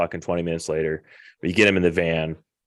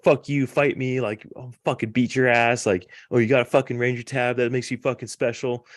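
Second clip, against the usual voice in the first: higher mocking jeer, picking a fight; retold drunk taunt; 6 semitones higher than usual. Drunk private taunting the sergeant into a fight, mocking jeer. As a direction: sneer it like a drunk private itching to brawl.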